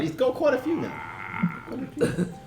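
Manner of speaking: high joking voice. Pretend. Player jokes about bolts.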